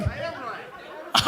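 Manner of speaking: slow, breathless mumble to himself; mock mumble. Comic mocking a heckler muttering to himself. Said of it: "Now he's just talking to himself".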